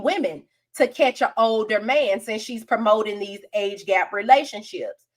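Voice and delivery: normal talking voice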